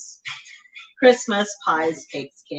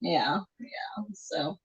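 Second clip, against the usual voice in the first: whisper; 5 semitones lower, 12 dB quieter, much slower than usual. Real, not acted. Quiet aside.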